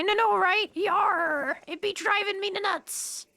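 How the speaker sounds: high hammed-up pirate. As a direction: growl it out like a cartoon pirate. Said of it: "the pirate says".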